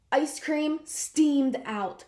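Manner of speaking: ordinary delivery, nothing marked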